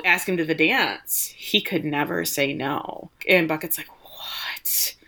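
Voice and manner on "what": breathy, whispered disbelief; mock-stunned whisper. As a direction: whisper it, acting taken aback. Plays Bucket stunned by the suggestion.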